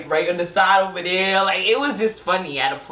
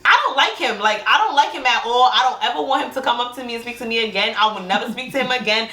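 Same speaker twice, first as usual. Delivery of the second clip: higher-pitched, worked-up rant; going off; 4 semitones higher than usual. Retold or imitated. Ranting to her cousin about the man, going off. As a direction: reel off the complaints, indignant and insistent. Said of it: "Come see me going off".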